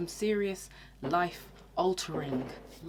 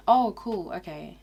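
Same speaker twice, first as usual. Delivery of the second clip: disinterested; 2 semitones higher, 7 dB louder than usual. Retold or imitated. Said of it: "really low energy".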